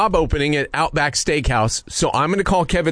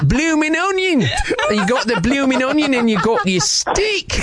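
higher, laughing put-on Australian; Australian accent; 7 semitones higher than usual. Prank caller hamming Australian while pitching the blooming onion. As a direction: lay the Aussie accent on thick, cracking up. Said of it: "now you sound British to me".

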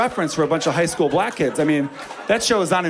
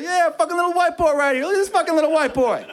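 hyped teammates; 13 semitones higher than usual. Retold or imitated. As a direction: cheer him on, way up high.

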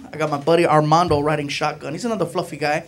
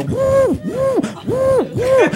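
high frantic woo; much slower than usual. Retold or imitated. Frantically airing out the car.

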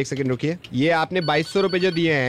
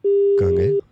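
suddenly much higher, calling out as if the line dropped; mock surprise; 14 semitones higher, 4 dB louder, much slower than usual. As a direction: pretend the caller has vanished. Prankster fakes losing the caller. (बनावटी हैरानी से ऊँची आवाज़ में पुकारना)

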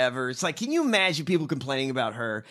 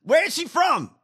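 much higher, louder disbelief; incredulous; 11 semitones higher, 5 dB louder than usual. Real, not acted. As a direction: demand an answer in shock. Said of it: "incredulous at her accent".

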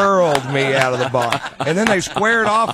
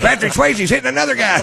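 mock dumb bouncer; 11 semitones higher, 5 dB louder than usual. Mocking Roadhouse-loving bouncers.

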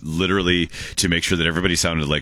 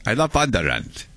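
fuller Viking accent on "I love Wonderland", more put-on; 4 semitones higher than usual. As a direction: lean harder into the Viking accent. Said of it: "Viking accent".

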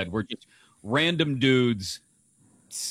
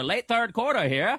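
put-on high old radio play-by-play; old-timey radio voice; 9 semitones higher than usual. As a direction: call it like a 1930s radio announcer. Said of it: "Old-timey radio voice".

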